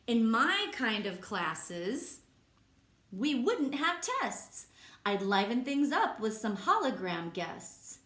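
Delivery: lively, sing-song read-aloud voice; reading to children. Acted. Teacher reading a rhyming book to kids, lively. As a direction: bounce the rhymes for the class.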